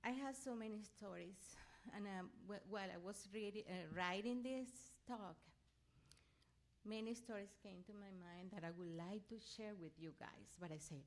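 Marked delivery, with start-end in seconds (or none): none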